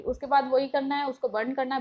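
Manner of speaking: ordinary talk, nothing marked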